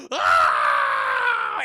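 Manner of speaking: loud, piercing scream of horror; full horror-movie scream; horrified scream. Retold or imitated. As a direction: scream at what she sees. Mother sees rotting legs.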